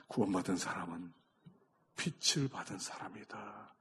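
low, soft pulpit voice with long pauses; hushed preaching. Real, not acted. Preacher explains seeing God after scales fall, hushed. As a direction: quietly let the truth settle.

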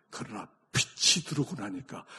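normal talking voice

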